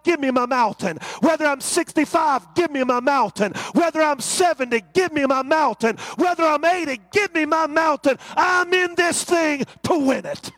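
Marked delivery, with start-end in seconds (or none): none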